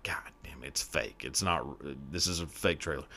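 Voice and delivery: low, soft deflated grumble; acted quiet disappointment; mock letdown. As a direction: let the hype sag, quietly. Acting out letdown at fake trailer.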